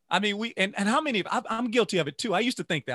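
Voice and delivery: owning up with energy; animated confession. Real, not acted. Admitting guilt, raised voice.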